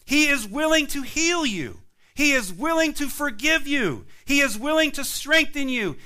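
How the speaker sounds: stirring altar-call refrain; rising preaching. Real, not acted. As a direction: build it like a revival sermon. Preaching builds to full force.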